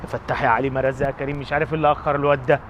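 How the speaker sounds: low, soft grumble of an irritated father; muttered prayer. Acted. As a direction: mumble the prayer to yourself, annoyed. Man mutters prayers to himself.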